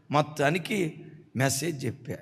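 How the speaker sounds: ordinary talk, nothing marked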